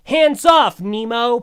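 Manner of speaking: high, loud hero's shout; heroic shout. Retold or imitated. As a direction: burst in yelling to the rescue. Acting Jay charging in to rescue.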